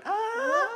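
high, drawn-out fake moan; mock moan. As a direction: let out a shrill mock moan. Imitating virus moan.

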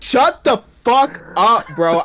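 loud, high, drawn-out threat; slow, shouted comic fury; mock furious outburst. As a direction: explode in hammed-up rage. Blows up at co-host over name.

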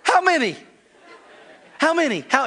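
put-on very high, loud frantic child; panicky kid. Son stalling his spanking, frantic high voice. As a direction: stall frantically, laughing through it. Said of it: "And he'd say".

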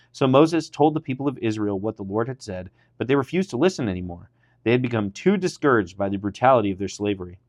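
ordinary delivery

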